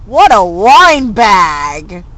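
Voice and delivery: loud, slow, drawn-out jeer; hammed-up scornful jeer; mock jeer. Real, not acted. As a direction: sneer the insult out long. Jeering remark.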